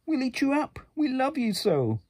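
high, loud begging monster voices; pleading wild things. Acted. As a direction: plead for Max to stay. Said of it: "the wild things cried".

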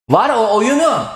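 loud, high outburst at the game; exasperated shout. Real, not acted. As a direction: blow up at the broken game. Game disconnects, cursing it.

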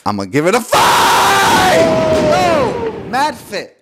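a very high, loud, excited yell; hyped shout. Real, not acted. Giving the difficulty score to the camera, a high excited yell. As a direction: scream the rating, totally pumped.

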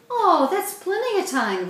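breezy, eager to lure them along; cheerful. A narrator's reading. Lydia brushing off Mandy's worry, cheerful and high. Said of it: "Lydia said cheerfully".

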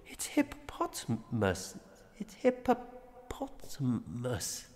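slow, soft, down to a whisper; fading whisper. A narrator's reading. Father giving up on correcting, fading whisper. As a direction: let it fade to a broken whisper.

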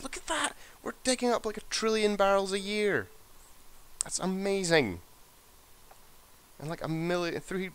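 high, gleeful praise; excited. Real, not acted. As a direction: squeal with delight at the numbers. Delighted at the oil output.

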